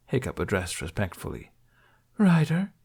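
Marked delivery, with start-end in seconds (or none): 2.2-2.7 empress's voice. Acted. Empress greets Hiccup coolly.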